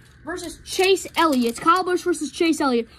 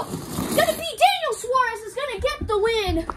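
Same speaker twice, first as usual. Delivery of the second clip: high-pitched winner announcement; excited race call; 5 semitones higher than usual. Real, not acted. Commentator calling the winner of the heat, excited. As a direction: burst out with the winner, voice raised.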